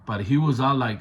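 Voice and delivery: ordinary talk, nothing marked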